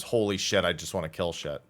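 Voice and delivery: ordinary talk, nothing marked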